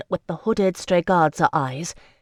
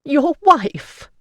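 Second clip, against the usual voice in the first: high, loud mocking exclamation; scornful laugh; 10 semitones higher, 8 dB louder than usual. A narrator's reading. Marie Celeste mocking Ricciardo's mention of a wife, laughing scorn. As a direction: scoff at him in disbelief.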